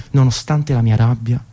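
ordinary talk

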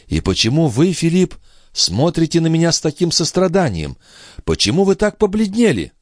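put-on high, alarmed young woman's questions; anxious young mother. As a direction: question them in a high, worried voice. (высокий, встревоженный голос молодой матери)

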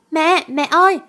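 put-on a scared boy wailing for his mother; crying out for mom. Boy cries out as mom runs into fight, tearful call. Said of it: "Thằng bé bật khóc".

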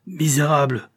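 furious cry at the count; shouted insult. Acted. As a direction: spit the insult as he aims. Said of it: "criait-il en le visant".

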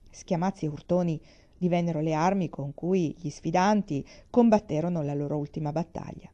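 ordinary delivery

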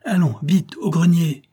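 high, urgent order; calling out. A narrator's reading. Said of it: "me cria-t-il".

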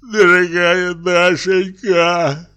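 dying man moaning to Dashenka. A narrator's reading. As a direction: moan her name as if dying. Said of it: "простонал Стрижен".

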